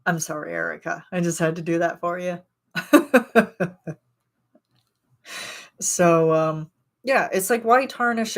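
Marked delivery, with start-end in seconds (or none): 2.7-3.9 higher, louder burst of laughter; laughing. Real, not acted. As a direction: laugh out loud at your own joke. Laughing after her own joke.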